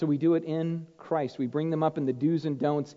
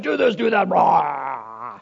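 bossy dad; 6 dB louder, much slower than usual. Retold or imitated. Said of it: "instead I'm just like".

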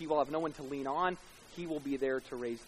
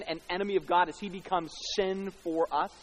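normal talking voice

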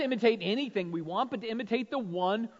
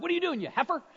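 higher-pitched mock TV uncle voice; 7 semitones higher, much faster than usual. Dad copies son doing a TV uncle, high mock rebuke. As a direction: imitate a kid parroting a Duck Dynasty character, pitched up. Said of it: "he loves to go Uncle Si".

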